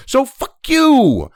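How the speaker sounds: slow, deliberate fuck-off; slow contempt. Real, not acted. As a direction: spit it out slowly at them. Ranting at Biden administration.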